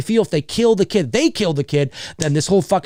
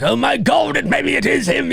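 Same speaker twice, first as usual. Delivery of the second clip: high-pitched mock panic; hammed-up frantic Loomis; freaking out; 10 semitones higher than usual. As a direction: play a man freaking out, voice way up. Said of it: "'cause he's freaking out".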